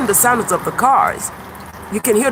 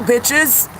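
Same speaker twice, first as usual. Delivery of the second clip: normal talking voice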